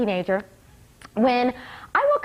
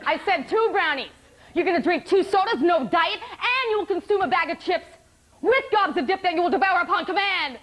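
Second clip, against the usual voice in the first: mock drill sergeant, raised pitch, hard commands; drill sergeant barking orders; 4 semitones higher than usual. Psych tech commanding patients to eat, hammed-up drill sergeant. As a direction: order them like raw recruits. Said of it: "barking orders at his assembly line".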